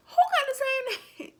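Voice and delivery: high, loud, laughing disbelief; who, me? laugh it off in shock; high, incredulous laughing question. Retold or imitated. Disbelieving retort.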